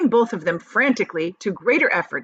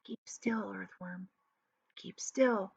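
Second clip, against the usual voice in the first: much softer hushed plea; whispering; 12 dB quieter, slower than usual. A narrator's reading. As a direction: keep it hushed and tense. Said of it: "whispered James".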